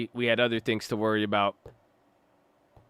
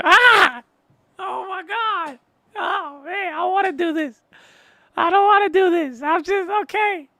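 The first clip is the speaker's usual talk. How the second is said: much higher, cartoonish frightened squeal of a voice, begging; squeaky panicked voice; 3 dB louder than usual. Streamer hamming up his fright at the scare, squeaky and pleading. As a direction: play a terrified little voice pleading for mercy. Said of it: "that scared me".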